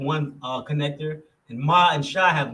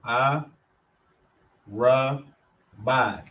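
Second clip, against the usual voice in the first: A, Ra, Ba, I spelled out with pauses between; syllable by syllable; much slower than usual. Shown as a demonstration. Teacher sounding out Arabai in separate syllables. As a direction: break Arabai into separate beats.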